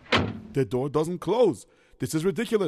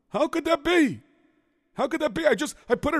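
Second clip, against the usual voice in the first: disbelieving; 10 semitones higher than usual. Acted. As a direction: cry out in disbelief. Key missing from tire.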